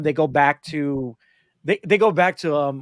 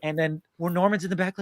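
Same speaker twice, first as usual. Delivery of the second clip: ordinary talk, nothing marked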